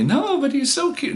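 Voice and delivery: put-on very high girly whine. Tipsy girl insisting he's cute, high whine. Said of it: "groping me".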